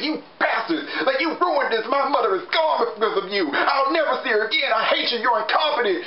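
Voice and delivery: high-pitched hammed-up ranting at his partners; mock furious rant. Olympius rants at the demons who lost his mother, hammed-up high yelling. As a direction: shriek the blame at them in mock rage. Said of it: "Olympius just went on this rant".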